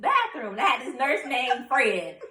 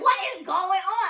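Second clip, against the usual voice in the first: high, slow, drawn-out bafflement; retold alarmed confusion; freaking out; 9 semitones higher than usual. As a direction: stretch each word in mock panic. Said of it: "I'm freaking out".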